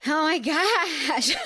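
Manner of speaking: slow, high, laughing disbelief. Real, not acted. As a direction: laugh through it, stunned.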